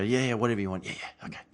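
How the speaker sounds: seduced agreement, fading. Retold or imitated. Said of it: "and you go".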